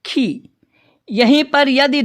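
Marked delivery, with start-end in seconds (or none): 0.0-0.5 खी with a short i, clipped; short khi. Shown as a demonstration. Teacher demonstrating short i to students. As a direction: say खी briefly, short vowel. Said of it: "तो इसका उच्चारण करेंगे".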